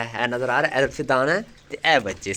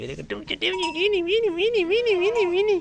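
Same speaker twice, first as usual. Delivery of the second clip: high, slow sing-song call of मीनी मीनी; cooing to an animal; slower than usual. Real, not acted. Cooing to the goat kid.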